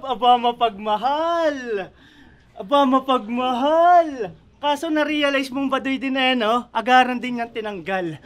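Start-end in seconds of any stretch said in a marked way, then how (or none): 0.0-1.9 slow teasing drawl, stretched final word; drawn-out mockery. Battle rapper mocking rival's old love name, drawn-out teasing. 2.6-4.3 slow, swooping teasing drawl; drawn-out mockery. Battle rapper repeating a jibe at rival, drawn-out teasing. Mocking opponent's old rap name, repeated.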